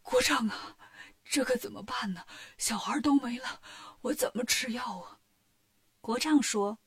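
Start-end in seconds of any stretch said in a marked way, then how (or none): 0.0-5.2 faint, half-whispered alarm; a frail king in a quiet panic; the sickly king learns the children are gone; weak, alarmed king. A narrator's reading. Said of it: "国王大吃一惊".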